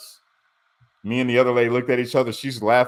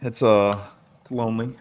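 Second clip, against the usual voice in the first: normal talking voice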